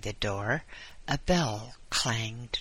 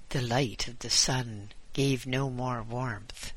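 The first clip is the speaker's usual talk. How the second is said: ordinary delivery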